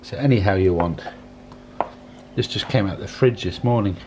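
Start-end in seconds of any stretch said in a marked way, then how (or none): none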